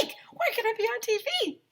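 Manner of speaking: high squealing; giddy girl squeal. Acted. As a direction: shriek with delight. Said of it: "girls started screaming".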